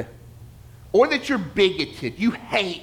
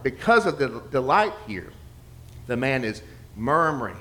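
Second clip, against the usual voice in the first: ordinary talk, nothing marked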